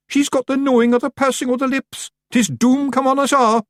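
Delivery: high, loud Scottish pixie cry; terrified Feagle shouting high; panicked shout. Acted. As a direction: shout the alarm in dread.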